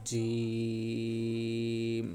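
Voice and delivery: জিম held very long, three alif counts; long jim. Shown as a demonstration. Teacher modelling a three-count jim, drawn out. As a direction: stretch the vowel of জিম long and even. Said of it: "বলতে হবে".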